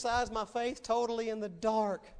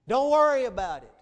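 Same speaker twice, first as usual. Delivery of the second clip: loud exhortation; 3 semitones higher, 11 dB louder than usual. Real, not acted. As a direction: burst out loud, preaching at full force. Preacher exhorts the congregation.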